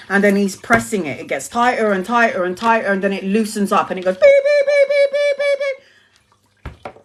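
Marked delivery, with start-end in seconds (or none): 4.2-6.9 imitated beeps. Teller mimicking the blood pressure machine with very high beeps.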